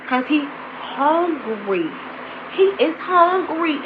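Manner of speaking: HUNGRY dragged out slowly; slow, deliberate stress on "hungry"; emphatic insistence. Real, not acted. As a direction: stretch "hungry" long, word by word. Emphatic answer to her own question.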